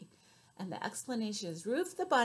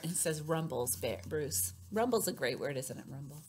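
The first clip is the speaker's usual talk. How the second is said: usual speaking voice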